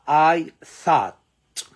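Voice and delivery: I thought, with thought said slowly and clearly; pronouncing thought. Shown as a demonstration. Teacher slowly models I thought for students. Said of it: "خلي بالك هنا مش thought".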